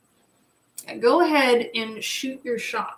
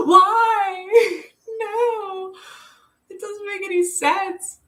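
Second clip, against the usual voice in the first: high laughing cry of protest; laughing whine; 11 semitones higher, 7 dB louder, much slower than usual. Retold or imitated. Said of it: "And I'm like".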